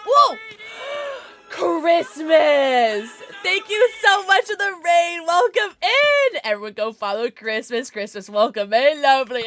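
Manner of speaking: high, loud excited greeting; excited shout. Real, not acted. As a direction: greet the raid with glee. Thanking raider excitedly.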